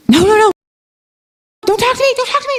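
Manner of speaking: high, urgent no no no; frantic brush-off. Retold or imitated. To her band, frantic to keep the song. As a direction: wave the band off, mid-inspiration. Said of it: "And I was like".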